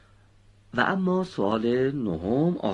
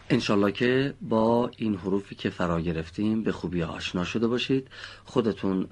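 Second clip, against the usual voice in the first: ordinary delivery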